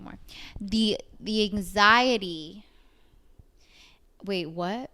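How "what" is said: normal talking voice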